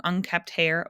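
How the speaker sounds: usual speaking voice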